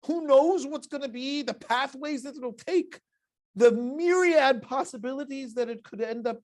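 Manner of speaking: high, loud, slow rhetorical questions; dramatic musing. Acted. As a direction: marvel aloud at endless possibilities. Dramatizing the uncertainty.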